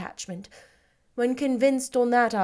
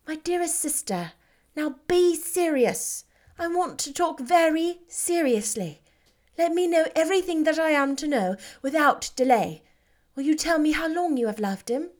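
higher sister's voice, slowing on be serious; urging her sister to speak plainly; Jane, serious; 7 semitones higher than usual. Acted.

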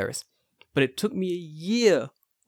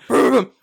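loud imitated revs, pitched up; motorbike engine noise; 8 semitones higher, 9 dB louder than usual. Teacher pulling up on a Harley, mimicked engine. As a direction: rev it like a motorcycle. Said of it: "and I hear this".

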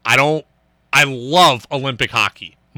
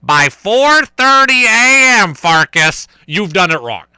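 four thirty A M said much higher and louder, slowly; booming mock coach; imitating coach; 6 semitones higher, 7 dB louder, slower than usual. Host imitating his college coach scolding him. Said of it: "he would come back and tell us".

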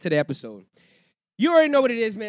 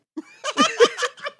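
loud high laugh; 14 dB louder than usual. Real, not acted. Laughing at the singer.

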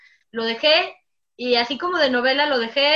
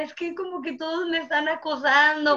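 high mock-tearful whine; retold weepy complaint, pitched up; retold crying; 4 semitones higher than usual. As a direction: imitate yourself crying to mom. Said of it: "lloraba con mi mamá".

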